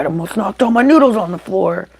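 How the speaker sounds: ordinary delivery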